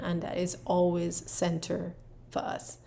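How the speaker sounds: ordinary talk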